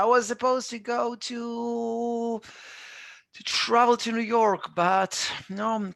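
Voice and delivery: high, drawn-out lament; acted disappointment. Teacher lamenting a cancelled New York trip, acted disappointment. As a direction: complain about spoiled plans, pitched high.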